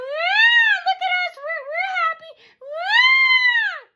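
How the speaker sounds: piercingly high, loud shriek; mock screaming. Imitating hecklers showing off that they're happy, screaming. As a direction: screech like the heckler. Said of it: "Dave would also scream".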